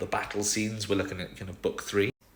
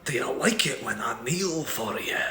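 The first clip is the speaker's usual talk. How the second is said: Scottish lilt, teasing and intimate; Scottish Rhysand; 4 semitones higher than usual. Acted. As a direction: purr it in a Scottish accent. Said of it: "got both Rhysand and Helion speaking definitely in a Scottish accent".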